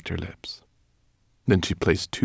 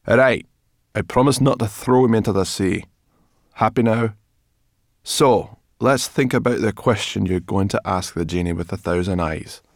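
loud, raised, impatient character voice; grudging brownie; 5 semitones higher, 8 dB louder than usual. A narrator's reading. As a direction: give in with bad grace, then move on briskly.

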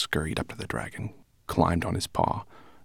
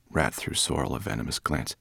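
ordinary delivery, nothing marked

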